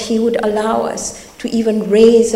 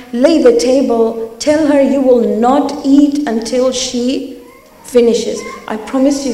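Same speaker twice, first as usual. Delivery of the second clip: forceful preaching; 3 semitones higher, 6 dB louder than usual. Acted. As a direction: drum it into the crowd. Urges the congregation forcefully.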